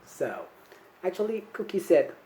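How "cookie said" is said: ordinary delivery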